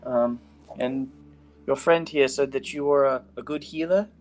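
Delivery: a hint of a timid healer; lightly voiced young woman. GM voices NPC Augusta.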